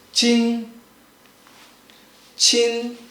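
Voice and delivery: CHIN held long on one flat, level pitch; first tone. Shown as a demonstration. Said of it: "make sure it's flat".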